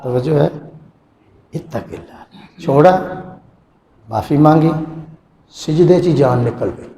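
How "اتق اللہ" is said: whispered plea. Acted. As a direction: whisper it slowly. (سرگوشی میں آہستہ)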